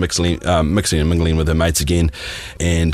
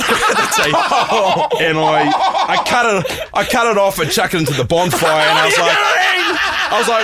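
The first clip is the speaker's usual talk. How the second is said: laughing; 14 semitones higher than usual. Real, not acted. Telling the climax, cracking up.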